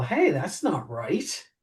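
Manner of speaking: ordinary delivery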